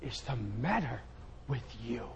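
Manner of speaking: quiet, low rebuke; God looking down on the preacher; a hint of God's voice. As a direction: ask it softly, like a disappointed father.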